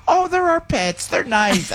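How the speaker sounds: high, slow sweet sister voice; high doting sister, half-laughing. Retold or imitated. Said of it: "She goes".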